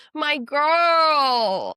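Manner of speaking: high, loud squeal of pride; loud, delighted shout; excited cheer. Real, not acted. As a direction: cheer her on like a best friend.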